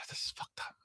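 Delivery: a soft whisper to himself. Real, not acted. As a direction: breathe it out in dismay. After sigh, under his breath.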